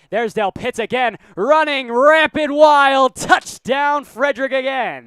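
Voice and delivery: high, loud excited touchdown call. Real, not acted. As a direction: shout the touchdown over the crowd.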